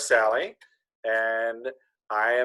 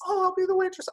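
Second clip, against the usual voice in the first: much higher, squeaky comic waitress; funny waitress voice. Acted. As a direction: pitch it way up like a cartoon waitress. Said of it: "I'm gonna do a funny voice".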